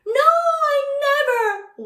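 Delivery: high-pitched amazed reply; high surprised. Pretend. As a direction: squeak it out in surprise. Answering for the audience.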